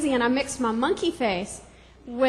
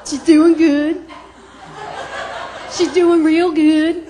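loud, cheery mom on the phone, much louder; proud chatty mom; 10 dB louder, slower than usual. Retold or imitated. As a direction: mom bragging to the villain on the phone. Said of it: "my mom would be like".

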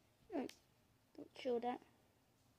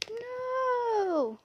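scream; 10 semitones higher, 4 dB louder than usual. Pretend. Pretend zombie attack.

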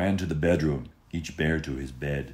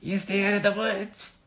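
much higher scolding mother voice; stern mother. Acted. Goldie's mother warning her daughter, high scolding voice. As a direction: warn the child off the woods. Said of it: "Goldie's mother would say".

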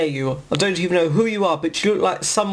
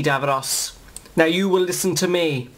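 ordinary delivery

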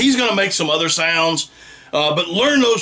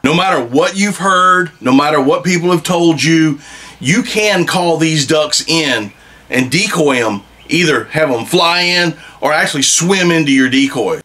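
louder and insistent; emphatic; 5 dB louder than usual. Real, not acted. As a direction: drive the point home, louder. Hunter insisting wood ducks can be called.

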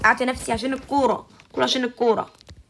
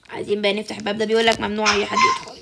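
ordinary talk, nothing marked

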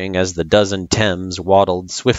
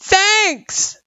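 high-pitched call across a distance; shouted thanks; 3 dB louder, much slower than usual. A narrator's reading. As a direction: shout it as if through cupped hands. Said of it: "she shouted".

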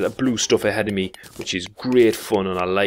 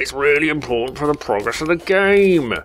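higher, louder mock viewer voice; imitating impatient viewers; 7 semitones higher, 5 dB louder than usual. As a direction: play the annoyed audience yelling advice. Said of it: "I know you're all probably thinking".

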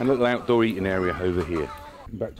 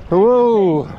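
loud high whoa; thrilled cry on the current; excited whoop; 12 semitones higher, 11 dB louder than usual. Real, not acted. Swept along fast river.

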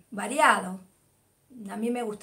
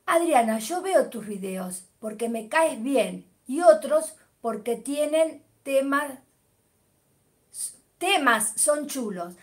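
slower, clear reading aloud; deliberate corrected read; careful reread. Shown as a demonstration. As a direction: read it properly this time, word by word. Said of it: "Ah, que lo lea bien".

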